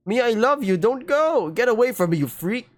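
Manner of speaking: desperate pleading. Acted. Ben begging Mia not to leave him, desperate pleading. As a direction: plead like you're losing her.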